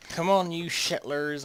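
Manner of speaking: rally the settlers with a slow loud call. Real, not acted. Urging settlers in game.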